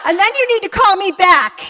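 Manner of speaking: high, loud mock-angry order; exaggerated exasperated demand; indignant ultimatum. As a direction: lay down the law, hammed up. Acted ultimatum to husband on phone.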